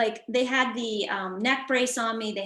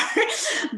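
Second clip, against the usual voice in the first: short laugh; 13 semitones higher, 4 dB louder than usual. Real, not acted. Laughing at her own thanks.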